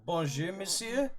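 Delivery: mock French accent. Greeting French guest in French.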